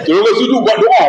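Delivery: high-pitched imitation wail; hammed-up weeping on a very high note; mock crying. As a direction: pretend to bawl while praying. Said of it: "Buat teriak kan?".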